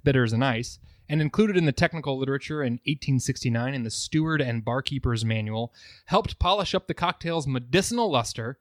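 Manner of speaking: soft reading aloud. Acted. Reads a historical newspaper passage.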